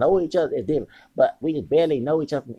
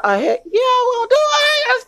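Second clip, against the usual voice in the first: mock overexcited squeal of agreement; squealing eager yes; 9 dB louder than usual. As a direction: shriek it out like a kid promised candy.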